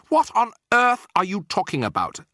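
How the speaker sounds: high, loud demand; exasperated high-pitched shout; indignant outburst. A narrator's reading. Insulted Bruno keeps shouting back.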